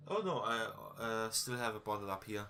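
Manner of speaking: ordinary talk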